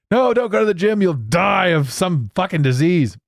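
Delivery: high, hammed-up panicky warning; exaggerated mock alarm; mock alarmist warning. Mocks covid-era warnings.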